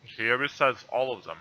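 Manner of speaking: usual speaking voice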